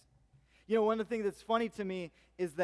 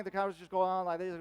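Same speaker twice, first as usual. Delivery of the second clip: lightly imitated country Southern twang. Shown as a demonstration. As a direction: slip into a rural Southern twang. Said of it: "there's a Southern twang".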